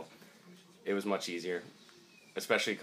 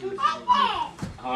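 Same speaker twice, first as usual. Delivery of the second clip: put-on baby talk; 6 dB louder than usual. Playing with baby.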